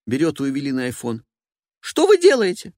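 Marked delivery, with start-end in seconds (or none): 1.8-2.7 high, loud and indignant; a woman snatching back her phone; shrill diva voice. Acted. Evelina voiced.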